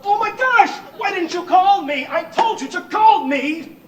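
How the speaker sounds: high, loud frantic outburst; panicked reproach. Acted. Host blaming his roommate for not calling him, frantic. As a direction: cry out in alarm, then scold.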